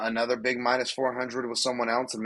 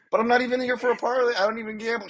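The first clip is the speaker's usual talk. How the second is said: high, put-on whiny voice, much higher; mock listener protest; 12 semitones higher, 4 dB louder, faster than usual. Co-host mocks a listener who doesn't gamble, in a high whiny voice. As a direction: play a skeptical listener who insists he doesn't bet.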